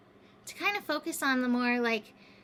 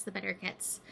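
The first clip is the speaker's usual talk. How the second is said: softer, lower, fading repeat; quiet; 5 semitones lower, 8 dB quieter than usual. Shown as a demonstration. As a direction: let it fall away softly.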